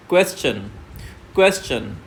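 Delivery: teacher models stress on क्वेश; stressed first syllable. Shown as a demonstration. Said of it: "question को ही हमें loud पढ़ना है".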